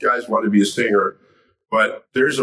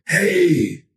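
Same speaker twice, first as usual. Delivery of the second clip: put-on higher-pitched old man's call; aged voice, raised in pitch; elderly man; 8 semitones higher than usual. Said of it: "I see this old man".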